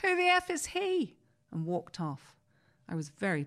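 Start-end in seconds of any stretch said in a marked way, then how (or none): 0.0-1.2 much higher, mock-outraged squawk; George's indignant retort. Director George sneering about the stubborn actor, high mock outrage. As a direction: squeal it like an offended boss. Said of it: "George went".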